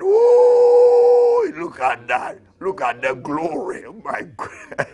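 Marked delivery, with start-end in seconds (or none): 0.0-1.6 high, loud ecstatic whoop; ecstatic ooh. Real, not acted. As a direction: cry out in rapture. Ecstatic cry in worship.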